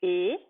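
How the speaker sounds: E held as a clear closed é; isolated vowel sound. Shown as a demonstration. Teacher models the é sound for the child. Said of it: "ça fait le son".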